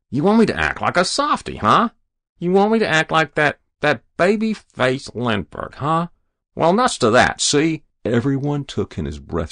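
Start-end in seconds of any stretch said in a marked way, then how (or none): 0.1-7.8 fast, high, loud mockery; nasty sneering. A narrator's reading. Young hero refusing to act like a softy, nastily. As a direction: jeer at them in a high, brash voice. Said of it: "he cut in nastily".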